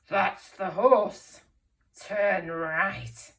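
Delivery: loud, slow villain voice; not very nice character. A narrator's reading. Teacher voices Len the thief for children, villain voice. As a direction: play the baddie, drawing the words out loudly. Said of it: "I did it in a different voice".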